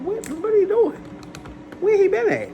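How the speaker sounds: high loud exasperated shout; shrill-free high furious demand; pissed high yell. Retold or imitated. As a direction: yell it up high like you're fed up. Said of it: "I was pissed".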